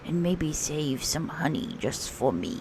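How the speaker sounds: whispered aside. Acted. Pooh Bear whispering to the children for honey. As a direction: sneak the honey request in a whisper.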